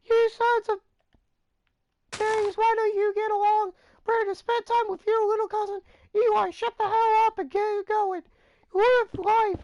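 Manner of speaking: high, loud squawking mom voice; mock angry mom. Mom Karen scolding both fighting cousins, high squawk. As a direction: scold like a shrill angry mother. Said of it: "She's like".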